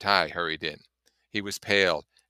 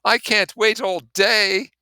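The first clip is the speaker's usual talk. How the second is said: much higher, louder and slow, giving up; despairing Gatsby; 12 dB louder, slower than usual. Acted. Gatsby telling the narrator nobody will come, high, loud, slow despair. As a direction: wail it out as if all hope is gone.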